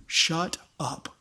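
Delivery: "Shut up." dropped low and soft, deadpan; blunt quiet punchline. Shown as a demonstration. Preacher summing up the Hebrew as a deadpan "Shut up.". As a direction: deliver "Shut up." flat and short as a joke. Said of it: "If I could sum that up".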